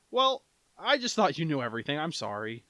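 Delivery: a hint of an embarrassed customer; lightly voiced, sheepish and smiling; sheepish apology. Customer recovering with a smile.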